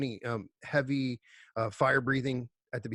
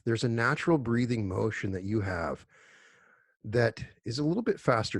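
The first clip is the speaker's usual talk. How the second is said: guided meditation. Acted. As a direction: guide them unhurriedly into stillness, letting phrases settle. Leads a group meditation.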